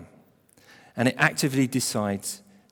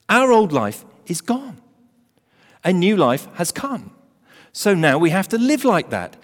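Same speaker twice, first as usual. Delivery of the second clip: full-force preaching; 6 semitones higher, 4 dB louder than usual. Acted. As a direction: preach it home to the congregation. Preaching climax on new identity.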